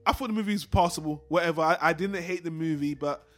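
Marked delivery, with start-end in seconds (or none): none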